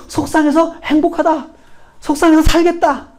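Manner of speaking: high, emphatic mock-cheerful claim; 긍정적으로 바꿔 말하기. Shown as a demonstration. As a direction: declare it brightly up high, as a demonstration. 한탄을 긍정으로 바꾼 시범. (높은 목소리로 힘주어 긍정하듯)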